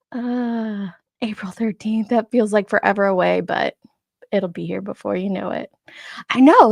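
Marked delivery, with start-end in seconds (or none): none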